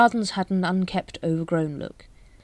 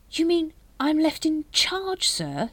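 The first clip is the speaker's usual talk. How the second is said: high, slow, dawning amazement; unbelieving delight; 11 semitones higher, slower than usual. A narrator's reading. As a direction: ask it through a slow, incredulous grin. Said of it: "broadened in a slow, unbelieving grin".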